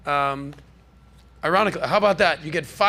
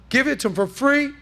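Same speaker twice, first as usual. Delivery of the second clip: higher, louder Trump-style sales boast; a hint of Trump's showman pitch; Trump impression; 7 semitones higher, 3 dB louder than usual. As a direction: brag it like Trump selling a deal. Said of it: "I'm talking like Trump right now".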